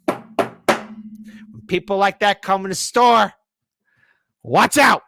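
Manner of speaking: slow, high-pitched ominous warning; mock warning. Real, not acted. Ex-retail worker warning about customers, mock ominous. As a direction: drag it out like a threat.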